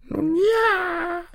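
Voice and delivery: very high, drawn-out crone voice; high little granny, stretching the word; pondering old granny. Acted. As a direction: stall thoughtfully in a tiny old voice. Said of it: "überlegte das Mütterchen".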